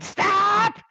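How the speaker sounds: very high, loud drawn-out scream. Acted. Lily screams in Davin's mind, high mock-desperate scream. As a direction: shriek it for him to halt. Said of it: "screaming in Davin's mind".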